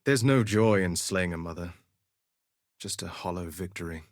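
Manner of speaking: low, subdued, fading away; sombre regret. Acted. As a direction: let it sink to a near-murmur. Duelist regrets killing a mother.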